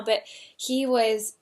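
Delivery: ordinary delivery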